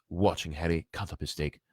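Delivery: ordinary delivery, nothing marked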